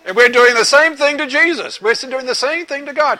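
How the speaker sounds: ordinary delivery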